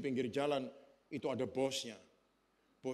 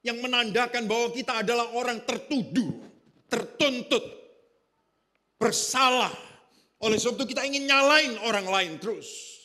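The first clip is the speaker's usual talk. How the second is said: building preaching crescendo; 8 semitones higher, 10 dB louder than usual. Acted. Pastor to the congregation about changed lives, building to a preaching shout. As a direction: climb with each "diubah" until you cry out.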